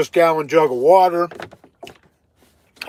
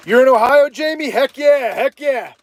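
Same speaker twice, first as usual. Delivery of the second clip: higher and louder, hyped up; answering a viewer from Ohio, loud and hyped; excited shout-out; 8 semitones higher, 3 dB louder than usual. Real, not acted.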